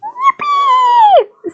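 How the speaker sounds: high squeaky yippee; squeaky cheer. Acted. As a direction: squeal it like a cartoon. Celebrates in a squeaky voice.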